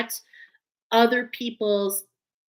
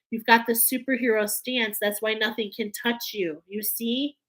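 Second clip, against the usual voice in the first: normal talking voice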